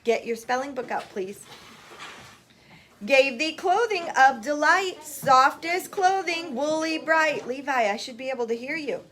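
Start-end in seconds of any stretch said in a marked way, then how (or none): 3.0-7.5 higher, measured verse reading; poem recitation. Acted. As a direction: recite slowly so children can join in. Teacher leads class poem recital.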